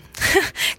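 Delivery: brief, high giggle; short giggle. A narrator's reading. Pale is amused by his teasing, short giggle. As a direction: give a quick little giggle. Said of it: "ein kurzes Kichern zu hören".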